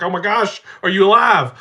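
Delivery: high, loud imitation of an alarmed child; mock child's alarmed shout; panicked six-year-old. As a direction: re-enact a kid yelling down to hurt dad. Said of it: "my six-year-old".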